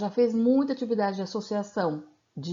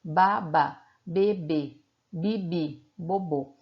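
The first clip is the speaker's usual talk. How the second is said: "Baba, bebê, bibi, bobô" one by one with pauses; each doubled syllable set apart, like a primer; paired syllable drill; 2 semitones lower, much slower than usual. Shown as a demonstration. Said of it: "depois a associação das sílabas". ("baba, bebê, bibi, bobô" pausado, como na cartilha)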